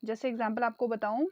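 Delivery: ordinary talk, nothing marked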